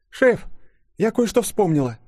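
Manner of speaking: high-pitched female character voice; Yulia, sudden recollection. A narrator's reading. Young colleague suddenly remembering something for the chief, high and sudden. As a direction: pipe up all at once, high. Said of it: "вдруг произнесла Юля".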